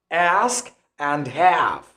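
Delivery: "Ask" and "have" with the A of cat; slow American front vowel in "Ask" and "have"; American A sound. Shown as a demonstration. Teacher recapping both words for learners, American vowels. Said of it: "difference between the American accent and the British accent".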